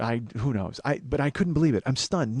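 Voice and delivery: ordinary talk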